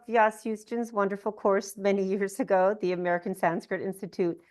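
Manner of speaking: usual speaking voice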